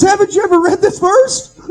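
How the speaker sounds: loud, high-pitched incredulous cry; imitated excited disbelief. New convert answering the preacher's doubt, acted excited disbelief. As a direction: exclaim it like the answer is obvious, nearly laughing.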